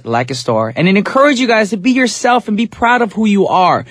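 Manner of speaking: ordinary talk, nothing marked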